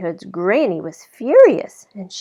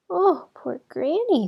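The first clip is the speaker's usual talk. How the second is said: put-on little girl voice; 9 semitones higher than usual. A narrator's reading.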